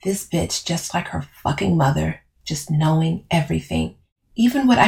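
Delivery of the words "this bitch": ordinary delivery, nothing marked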